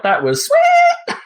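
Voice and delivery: shrill squeal, very high and slow; high-pitched shout. Retold or imitated. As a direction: stretch it into an ear-piercing squeal. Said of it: "With all the high-pitched, ear-piercing glory".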